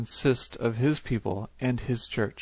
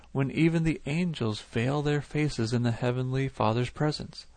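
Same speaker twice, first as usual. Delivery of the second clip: normal talking voice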